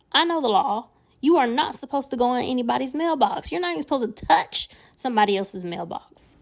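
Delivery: fed-up tirade, hitting the key words; livid. Retold or imitated. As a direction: lay down the law, outraged. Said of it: "I'm livid".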